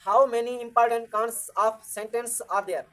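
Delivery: very slow, every word spaced for writing down; slow dictation. Shown as a demonstration. Teacher dictating the question to the class, very slow. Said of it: "दूसरा हमारा question क्या होगा".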